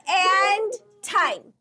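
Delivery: high, loud call to stop; calling time. Real, not acted. Coach calling time to end the celebration, loud. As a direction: shout time over the noise. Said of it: "start shouting, like, time".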